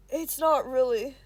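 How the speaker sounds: a mumble that comes out loud and slow; mumbled too loudly. Acted. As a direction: blurt it under your breath, but far too loud. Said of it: "mumbled a little too loudly".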